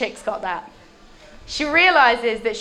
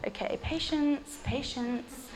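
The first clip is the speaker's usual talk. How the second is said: much softer, calming himself; patient Jesus; 2 semitones higher, 11 dB quieter, slower than usual. Acted. Jesus quietly telling himself to be patient with disciples. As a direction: murmur it to keep calm. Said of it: "And Jesus is like".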